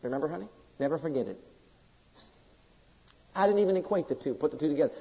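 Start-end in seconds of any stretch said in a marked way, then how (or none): 0.0-1.4 low, soft personal aside; quiet aside. Real, not acted. Preacher turning to his wife about a shared memory, quiet aside. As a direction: drop the voice for a private word.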